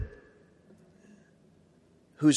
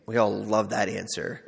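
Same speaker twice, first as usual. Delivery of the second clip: normal talking voice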